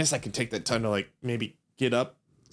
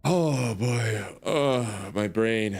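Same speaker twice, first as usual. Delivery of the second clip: normal talking voice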